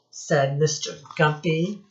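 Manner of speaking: plain reading voice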